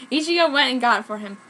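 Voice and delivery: ordinary delivery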